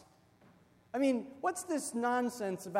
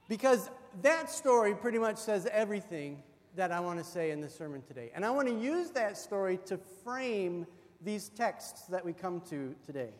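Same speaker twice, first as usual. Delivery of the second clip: ordinary delivery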